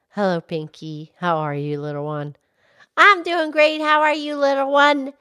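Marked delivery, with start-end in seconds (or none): 0.1-1.1 much lower, gentle cow voice; Mildred the cow. Acted. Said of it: "Mildred the Moo Moo Cow". 1.2-2.4 much lower cow voice asking kindly; Mildred the cow. Acted. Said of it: "Mildred the Moo Moo Cow".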